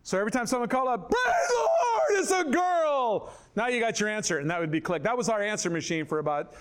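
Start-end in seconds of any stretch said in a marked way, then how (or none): 1.1-3.3 very high, loud, slow shout of joy; recorded cheer. Retold or imitated. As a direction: belt the answering-machine greeting. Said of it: "I had that recorded".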